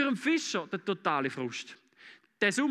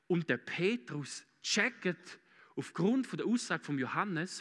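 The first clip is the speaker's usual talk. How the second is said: usual speaking voice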